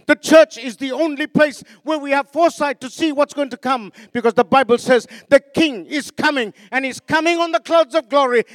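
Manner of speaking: loud, driving sermon delivery; preaching at full force. Acted. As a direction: preach it out to the whole church. Preaching to the congregation.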